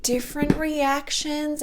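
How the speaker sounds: calm a nervous patient like the physio; the physiotherapist reassuring her patient, high and gentle; soothing physio. Retold or imitated. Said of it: "She's like".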